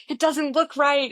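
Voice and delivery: high, loud frustrated outburst, retold; snapping in a backstage panic, not fully earnest; furious. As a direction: actor fuming at makeup backstage, furious.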